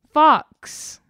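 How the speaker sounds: high, loud gasp of a word; spring the surprise on the listener; a storyteller's big shocked reveal; dramatic reveal. A narrator's reading.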